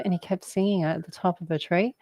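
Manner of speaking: ordinary talk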